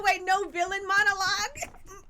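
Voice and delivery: high, loud sneer at the villain; mock the villain's amateur move. Mocking the villain.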